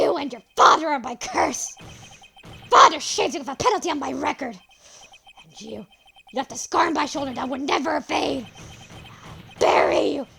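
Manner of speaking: loud, furious ranting; unhinged fury; villain rage. Acted. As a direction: scream your hatred. Villain raging.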